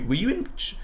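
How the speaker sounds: normal talking voice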